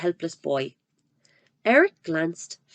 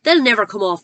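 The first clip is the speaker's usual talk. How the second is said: louder dismissive shout; shouted back; 3 semitones higher, 9 dB louder than usual. Acted. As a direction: fling it back. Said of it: "the Trunchbull shouted back".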